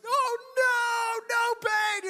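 high, loud mock wail of dread; hammed-up panicked cry; dramatic kid. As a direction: wail like an overdramatic child. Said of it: "it's so dramatic".

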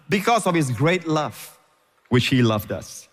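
"Because of His great love" slow, letting each word land; unhurried. Shown as a demonstration. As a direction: take your time over it. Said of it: "Don't rush".

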